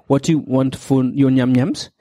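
ordinary delivery

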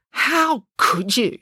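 slow, high-pitched accusation; demanding. A narrator's reading. Finn, arms crossed, confronts his big brother, demanding. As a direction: draw it out as an aggrieved demand. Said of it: "demanded Finn".

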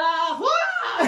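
high squealing cheer with a laugh; mock crowd cheer. Telling friends about the dance contest, laughing mock cheer.

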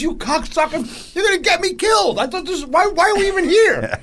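furious rant. Retold or imitated. The friend ranting furiously at his buddies for the mix-up. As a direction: rant at your friends, outraged. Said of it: "he was so fucking mad at us".

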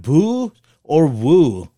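slow, loud game-show style choice; drawn-out verdict call. Pretend. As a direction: stretch out boo and woo. Calls for audience verdict, drawn out.

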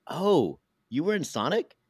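high-pitched acted "oh" of recognition; mock surprised reaction; retold surprise. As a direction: act out sudden recognition, pitch swooping up high.

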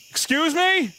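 high calling out. Acted. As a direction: call out high into the dark. Calling into dark bushes.

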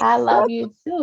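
high, tearful and affectionate; crying. Real, not acted. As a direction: answer through happy tears. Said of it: "You crying again".

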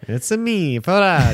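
put-on high Mario-style Italian accent. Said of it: "good Italian accent".

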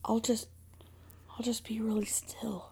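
very soft whisper; hushed aside. Real, not acted. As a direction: whisper it, trying not to disturb anything. Sheepish aside after mishap.

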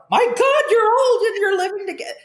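high, fast mock-scandalized gasp; hammed-up busybody outrage; shocked onlookers. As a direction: imitate nosy people clutching their pearls. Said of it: "people go".

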